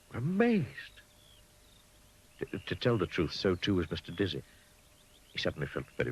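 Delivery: ordinary delivery, nothing marked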